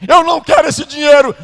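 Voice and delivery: high, loud tearful refusal; father shouts he won't take the money back; father refusing. Retold or imitated.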